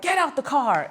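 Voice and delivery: high-pitched mock command; imitated angry order. Angry boyfriend orders her out.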